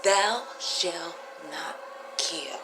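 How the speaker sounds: very slow, each word weighed; slow solemn quote. Shown as a demonstration. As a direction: lay the words down one by one. Repeats commandment, drawn out.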